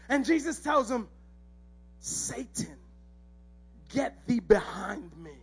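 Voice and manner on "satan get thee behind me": low, slow, hushed rebuke; quiet, weighty command with long pauses; grave rebuke. Acted. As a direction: rebuke him sternly without raising your voice. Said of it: "that kind of rebuke".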